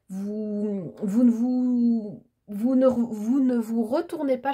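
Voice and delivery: real stammer, stretched false starts. Real, not acted. Tarot reader tripping over words to viewers, stammering. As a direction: stumble and restart on the opening words. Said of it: "je bégaye, hein, aujourd'hui".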